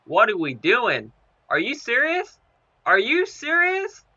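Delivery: climbing high and loud, slow and stretched in disbelief; incredulous outburst. Real, not acted. Gamer protesting a bad play, slow incredulous rising cry. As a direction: demand it in outraged disbelief.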